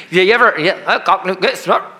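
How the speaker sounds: laughing through the words; amused laugh over the words. Real, not acted. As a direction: chuckle it out. Laughing at own joke.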